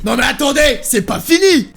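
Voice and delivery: angry interruption. Retold or imitated. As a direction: cut him off sharply. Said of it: "Il me dit".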